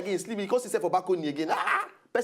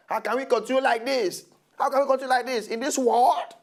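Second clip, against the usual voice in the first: high, loud exasperated protest; worked-up outrage; 6 semitones higher, 4 dB louder than usual. Pretend. Co-host lamenting the killings to viewers, mounting outrage. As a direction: build from pleading to provoked outburst.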